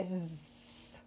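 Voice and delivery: "th" held as a soft dental sound; som de TH. Shown as a demonstration. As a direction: sustain the "th" softly. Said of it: "normalmente é T H". ("th" prolongado e baixinho, com a língua nos dentes)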